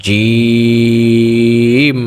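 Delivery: জিম held very long and chanted; three-alif long Jim. Shown as a demonstration. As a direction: draw জিম out for three alifs. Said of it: "তিন আলিফ টান দিয়ে". (তিন আলিফ টেনে জিম)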